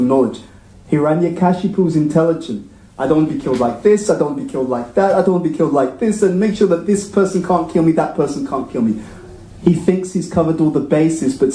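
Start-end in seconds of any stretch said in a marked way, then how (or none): 3.0-9.0 rapid demands. Retold or imitated. Demon listing his demands.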